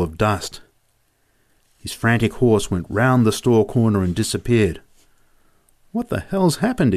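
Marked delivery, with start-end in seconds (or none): 5.9-6.9 startled exclamation. A narrator's reading. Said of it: "exclaimed Joe".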